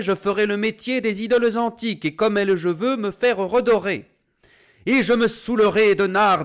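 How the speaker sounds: high, loud, mocking proclamation; wife crying out in public. A narrator's reading. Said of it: "Sa femme va criant sur les places publiques".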